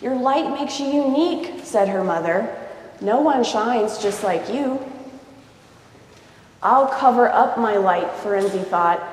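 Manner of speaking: lively read-aloud storybook voice; story narration. A narrator's reading. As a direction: read it to a child, voicing each character. Reads picture book aloud.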